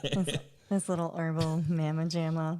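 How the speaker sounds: ordinary talk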